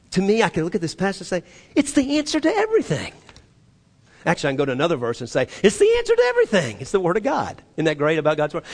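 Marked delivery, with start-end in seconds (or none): 1.7-3.1 high, fast exclamation; excited. Acted. Preacher acting out delight at a passage, excited. Preacher acts out his own enthusiasm. 5.6-6.8 very high, rapid exclamation; excited. Acted. Preacher acting out delight at another verse, excited.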